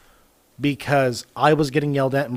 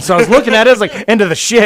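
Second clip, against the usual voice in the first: louder, higher, amused retelling of reading the line; breaking up over own typo while telling a friend, louder and higher; amused outburst; 7 semitones higher, 10 dB louder, faster than usual. Real, not acted.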